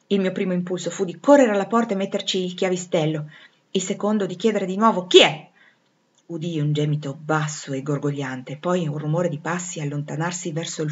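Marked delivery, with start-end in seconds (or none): 5.1-5.5 calling out. Acted. Calling out in the dark.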